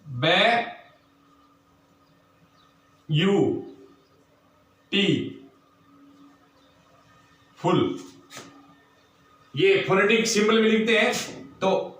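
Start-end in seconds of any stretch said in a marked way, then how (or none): 0.1-8.0 "बे यू टी फुल" said syllable by syllable, long pause after each, pitch sinking lower; split into syllables. Shown as a demonstration. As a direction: break beautiful apart, one slow syllable at a time. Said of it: "जैसे अपन करें beautiful". ("बे यू टी फुल" हर अक्षर अलग, बीच में लंबा विराम)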